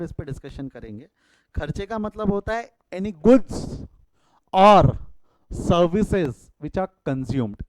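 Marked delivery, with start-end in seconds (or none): 2.9-7.6 slow, word by word definition; slow dictation. Shown as a demonstration. Teacher dictating a definition to the class, slow and deliberate. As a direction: spell the definition out slowly for notes. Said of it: "यहाँ important word क्या है".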